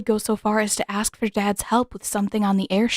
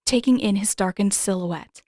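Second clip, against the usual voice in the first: ordinary delivery, nothing marked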